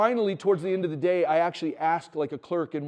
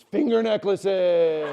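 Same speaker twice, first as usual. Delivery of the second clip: louder, slowly stretched triumphant cry; triumphant kid cry; 4 semitones higher, 9 dB louder, much slower than usual. Retold or imitated. Father imitating his son's proud announcement, stretched out. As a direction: proclaim it like a proud little boy. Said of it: "and he goes".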